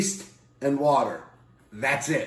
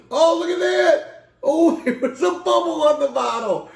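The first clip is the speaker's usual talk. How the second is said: delighted; 13 semitones higher, 4 dB louder than usual. Real, not acted. Delighted by beer pour.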